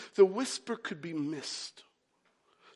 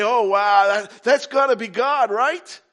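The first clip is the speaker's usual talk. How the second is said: loud, rising amazed exclamation; excited awe; 3 semitones higher, 11 dB louder than usual. Acted. Preacher voices an onlooker awed by the mighty wind, excited. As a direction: climb higher into an eager question.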